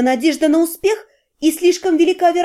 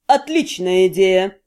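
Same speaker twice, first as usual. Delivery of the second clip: slow, lower male character voice; 7 semitones lower, slower than usual. A narrator's reading. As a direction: drop low and slow for him. Henrich approves, commanding.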